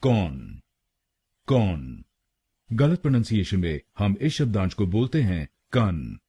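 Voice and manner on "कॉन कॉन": कॉन said low and isolated with an open o; teacher drills the first syllable कॉन; syllable demo. Shown as a demonstration. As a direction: model the syllable कॉन slowly, lower in pitch. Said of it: "हम बोलेंगे".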